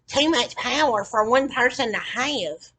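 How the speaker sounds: high, loud, drawn-out mimicry; scornful, stretched-out high voice; mocking quote. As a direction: throw their excuse back slowly and loudly. Mockingly repeats their stated reason.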